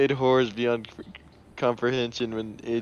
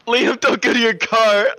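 loud, high warning call; warning a friend not to go to the car; 12 semitones higher, 9 dB louder than usual. Real, not acted. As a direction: shout it after him. Calling out to friend.

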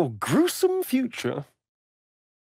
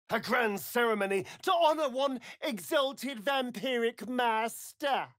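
ordinary talk

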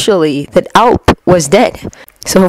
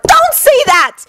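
sharp high bark of protest; slower than usual. A narrator's reading. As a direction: snap it out, pitched high. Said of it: "she barked".

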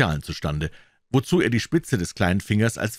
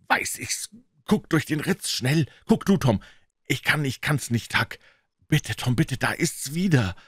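high, urgent hushed pleading; frightened whispering; 7 semitones higher than usual. A narrator's reading. Boys hear the howling dog, pleading in frightened high voices.

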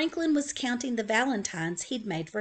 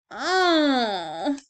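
drawn-out sad moan; 5 semitones higher, 7 dB louder than usual. Acted. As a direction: let the disappointment groan out. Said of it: "Franklin moaned".

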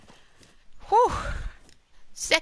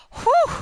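high relieved whew; 7 dB louder than usual. Real, not acted. Relieved exhale.

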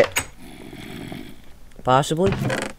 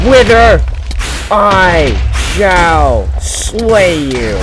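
grand booming battle cry, much higher and louder; mock heroic challenge; 5 semitones higher, 8 dB louder, much slower than usual. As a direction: proclaim it like a knight facing a dragon. Boss fight taunt.

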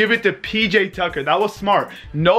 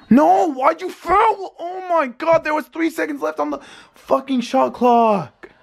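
real frustration; 9 semitones higher than usual. Real, not acted. Fan yelling at a foul on TV, frustrated. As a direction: yell it at the screen in real frustration. Watching his team blow the game.

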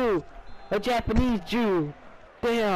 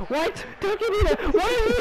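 indignant protest; 9 semitones higher, faster than usual. Pretend. Protesting a foul, rising.

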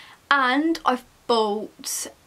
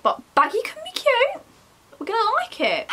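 high sing-song; 11 semitones higher than usual. Real, not acted. Talking herself into it.

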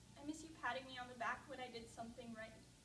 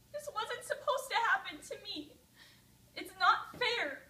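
high, loud, slow sobbing protest; grieving daughter sobbing at her father's grave; 9 semitones higher, 12 dB louder than usual. Acted. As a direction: break down crying.

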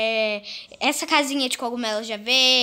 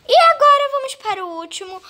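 excited announcement; 11 semitones higher, 7 dB louder, faster than usual. Real, not acted. Announcing the long-awaited room.

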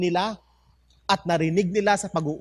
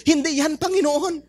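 greedy neighbor; 9 semitones higher, 3 dB louder than usual. Acted. Greedy neighbor answers the Lord.

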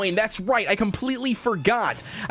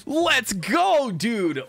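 normal talking voice

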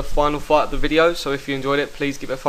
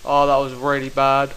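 usual speaking voice

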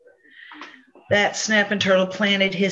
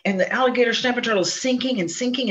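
ordinary delivery

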